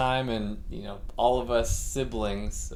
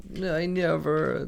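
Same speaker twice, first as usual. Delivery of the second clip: put-on whiny kid; 6 semitones higher than usual. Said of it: "working himself up with this story".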